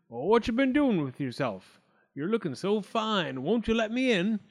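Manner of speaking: sly wolf laying on the charm; grinning wolf. A narrator's reading. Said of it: "grinned the wolf".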